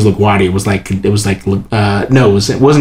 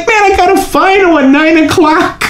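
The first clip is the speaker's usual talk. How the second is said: high, laughing protest; exasperated young self. Retold or imitated. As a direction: protest helplessly, half laughing. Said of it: "I'm like".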